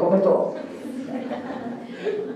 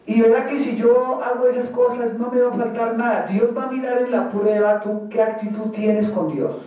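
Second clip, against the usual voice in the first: ordinary talk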